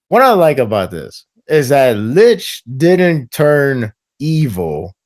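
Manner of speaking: normal talking voice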